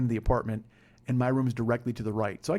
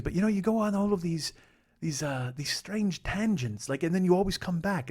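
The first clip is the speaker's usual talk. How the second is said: high put-on English accent; Paul; 5 semitones higher than usual. Flatmate commenting on the podcast, imitated English accent. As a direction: mimic a puzzled English flatmate, pitched up. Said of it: "your English accent is shit".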